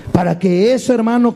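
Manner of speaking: ordinary talk, nothing marked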